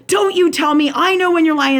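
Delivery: heated retold comeback; retold yelling. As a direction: imitate someone yelling an accusation. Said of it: "like, yelling at me".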